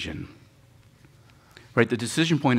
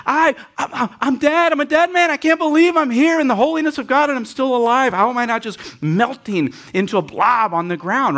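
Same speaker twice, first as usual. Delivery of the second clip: high, loud cry of dread; Isaiah crying out in terror before God; terrified prophet; 13 semitones higher, 7 dB louder than usual. Retold or imitated. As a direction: shout it like a man sure he is about to die. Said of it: "he goes".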